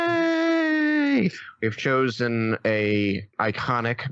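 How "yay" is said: very high squealed yay; high-pitched hooray; drawn-out cheer. Acted. Mock cheer at table reading announcement.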